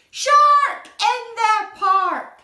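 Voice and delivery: slow, very loud alarm, fit to burst; shout. Acted. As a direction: yell it with everything you have. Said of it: "He shouts".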